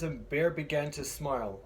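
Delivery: usual speaking voice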